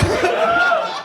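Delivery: squealing high-pitched laugh; a shrill horrified laugh. Retold or imitated. Reacts to Exorcist showing.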